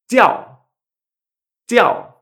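"jiao" high and loud, falling sharply; fourth tone. Shown as a demonstration. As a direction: hit "jiao" hard with a steep fall. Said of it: "en cuarto tono".

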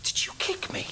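sleepy whispered question; whispering. Acted. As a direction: whisper back half-asleep. Sleepy reply in the dark.

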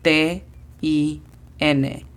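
letters spaced out for learners; spelled slowly for a beginner class. Shown as a demonstration. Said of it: "La pronunciación fonética es".

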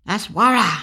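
loud, punchy call of Wara as a warning; exclamation. Shown as a demonstration. Teaching the word as a warning call, shouted exclamation. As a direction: bark out "Wara" like a sharp warning cry. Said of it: "You can say it like that too, the exclamation".

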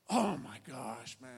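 slow, dropping "oh my gosh"; stunned dismay. Retold or imitated. Tourist realizing wallet is stolen, drawn-out dismay. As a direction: drag it out low as the loss sinks in.

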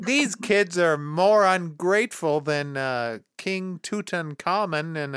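ordinary talk, nothing marked

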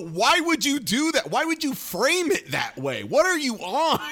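high, bewildered disbelief with a laugh; incredulous. Retold or imitated. Host asking the actress why, in baffled disbelief. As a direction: pitch it way up in baffled outrage. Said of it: "And I go".

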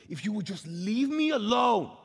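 high, loud plea building on the last words; exasperated outburst. Retold or imitated. Fed-up believer complaining to God, exasperated outburst. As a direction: let frustration swell into a retold yell. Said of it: "so frustrated".

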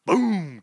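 loud, high mock explosion; comic-book boom effect shouted; explosion noise. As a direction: blurt out a big boom sound. Retelling an explosive comic exit.

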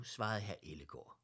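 plain reading voice

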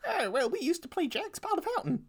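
high, wobbly granny voice; old grandma voice. Pretend. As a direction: play a sweet little old grandma. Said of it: "a grandma?".

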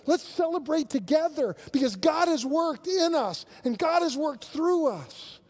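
high, loud, driving sermon voice; fervent preaching. Acted. Pastor urging his congregation to share, in full-force preaching. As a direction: press the point home to the congregation.

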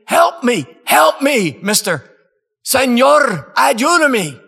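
high, loud pleading shouts; desperate yelling. Retold or imitated. Prisoner yelling to the visiting preacher, high desperate plea. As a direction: cry out for help through the bars. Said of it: "And he's yelling".

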